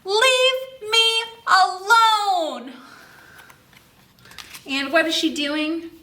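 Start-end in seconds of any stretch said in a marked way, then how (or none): 0.0-2.7 high, loud, very slow sing-song shout; drawn-out shout. Shown as a demonstration. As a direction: stretch out "Leave me alone" as a loud, high, sung call for the class to join in. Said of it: "Just shout it as loud as you can".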